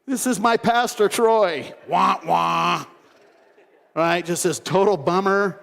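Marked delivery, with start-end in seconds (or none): 0.0-1.7 host announcing the pastor to guests, forced cheer; awkward announcement. Retold or imitated. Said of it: "you would call an audible". 1.9-2.8 sad trombone. Retold or imitated.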